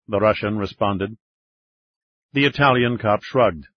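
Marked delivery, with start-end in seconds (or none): none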